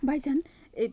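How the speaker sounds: ordinary talk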